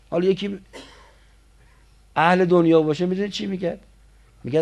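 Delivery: ordinary delivery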